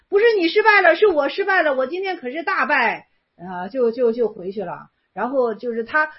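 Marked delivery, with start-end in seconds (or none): 0.1-3.1 loud, higher, then settling down; monk's loud retort. Acted. Monk answers master in loud protest. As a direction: fire it back at the master. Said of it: "僧曰".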